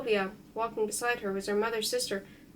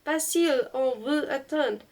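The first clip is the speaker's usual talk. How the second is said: father calling from the veranda, higher and louder; raised-pitch French summons; 3 semitones higher, 5 dB louder than usual. A narrator's reading. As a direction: call him in, raised voice, in French. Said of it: "my father called him from the veranda".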